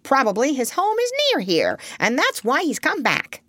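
high, indignant character voice; testy, high-pitched retort; snappish. A narrator's reading. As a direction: scold crossly, pitched well up. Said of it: "snapped Polynesia".

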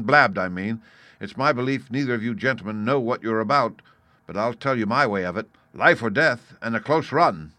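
lightly voiced captain laying down his objections; blunt captain, airing grievances. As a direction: state it firmly, punching key words.

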